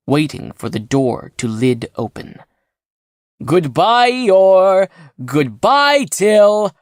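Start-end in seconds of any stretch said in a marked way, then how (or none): 3.4-6.7 loud, high farewell yell; triumphant shout. A narrator's reading. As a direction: shout goodbye like you've won. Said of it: "he shouted in triumph".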